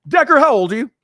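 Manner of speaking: ominous question from an angry boss; stern manager. Retold or imitated.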